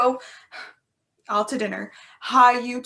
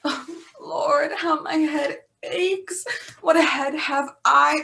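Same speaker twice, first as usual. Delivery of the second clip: slow, higher whimpering complaint; hammed-up pained whine; mock moaning; 3 semitones higher than usual. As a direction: play up the aching head. Nurse moans about headache.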